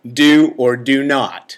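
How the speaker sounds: slow, loud and deliberate wise master; slow solemn sage, a laugh slipping in; Yoda. Retold or imitated. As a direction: lay down the rule slowly, with weight. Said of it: "what's Yoda's response".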